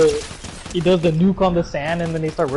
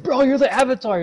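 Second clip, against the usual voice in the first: high, incredulous protest; mock disbelief; 9 semitones higher than usual. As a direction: imitate someone amazed at such modesty. Acting out disbelief at Aang.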